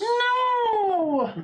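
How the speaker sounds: long, high mock-despairing cry; dismayed wail. Player learns of losing status, high dismayed wail.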